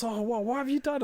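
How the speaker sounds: high, exasperated telling-off; scolding mum. Retold or imitated. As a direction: mum telling off her kid. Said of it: "she goes".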